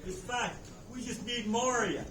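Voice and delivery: usual speaking voice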